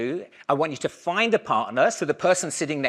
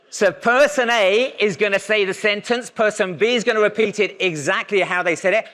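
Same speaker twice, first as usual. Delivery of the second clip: raised, higher voice calling instructions to the class; projecting to a room; 6 semitones higher, 7 dB louder than usual. Acted. Lecturer calling out instructions to the audience, projected and raised. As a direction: project instructions across a hall. Runs and then stops the exercise.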